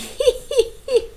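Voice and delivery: high gleeful cackle; wizard's laugh. Acted. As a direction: let out a delighted high laugh.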